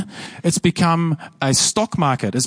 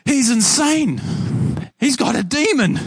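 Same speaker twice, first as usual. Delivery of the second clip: high, mocking crowd voice quoting the accusers; lightly acted scornful onlookers; scoffing accusation; 10 semitones higher than usual. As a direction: mimic the crowd scoffing at him.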